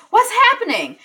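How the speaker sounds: a high, loud alarmed yell from the hallway. Retold or imitated. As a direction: shout it out in sudden alarm. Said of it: "and yelling".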